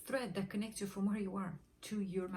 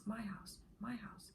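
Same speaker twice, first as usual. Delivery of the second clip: fading softer into a whisper; 2 semitones higher, 8 dB quieter, slower than usual. Shown as a demonstration. Speaker demonstrating an affirmation, fading to a whisper. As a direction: let my house die away to a whisper. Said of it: "affirmation demo".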